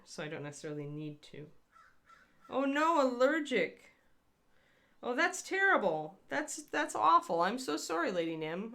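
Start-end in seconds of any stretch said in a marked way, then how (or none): 2.5-8.1 high, loud dismay for a viewer's allergy; sympathetic oh no. Real, not acted. Hearing a viewer is allergic to avocado, high sympathetic dismay. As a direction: gasp out real sympathy, much higher.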